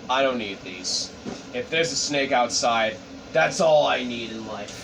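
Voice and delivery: low, subdued hero line; low-voiced Romeo. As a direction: keep it down low, like a brooding character. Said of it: "he's like".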